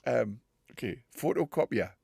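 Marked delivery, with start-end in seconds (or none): none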